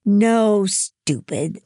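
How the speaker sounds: loud, high, scornful correction; sneering. A narrator's reading. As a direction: snub the slow listener with a sneer. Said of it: "Louisa sneered".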